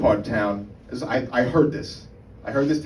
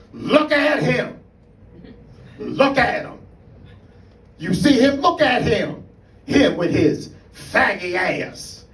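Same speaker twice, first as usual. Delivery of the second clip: high mocking taunt; 10 semitones higher, slower than usual. A guy on a mattress on a stoop jeers at a passing friend, high and slow. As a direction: stretch each word out and crow it at him. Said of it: "he goes, just like this".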